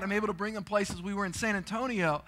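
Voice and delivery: ordinary talk, nothing marked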